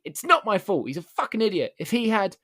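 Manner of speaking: defensive shout. Retold or imitated. Customer shouting excuses at the store manager, angry loud protest.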